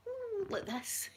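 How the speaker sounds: high, soft drawn-out mock ooh; softly squealed make-believe thrill; pretend thrill. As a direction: play at being on a roller coaster. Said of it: "I'll be doing that".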